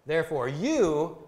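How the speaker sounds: you stretched long, high and loud; stress on you. Shown as a demonstration. Translator showing the emphatic Greek pronoun, stress on you. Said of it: "it's an emphatic pronoun".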